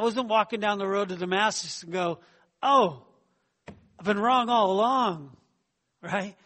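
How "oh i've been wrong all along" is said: higher, louder mock gasp of realisation; imitating a startled convert; mock revelation. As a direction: ham up a sudden change of heart. Mock sudden realisation on Damascus road.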